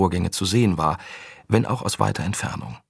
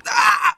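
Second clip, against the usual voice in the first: piercing scream; 5 dB louder than usual. Acted. Soldier cut off by scream.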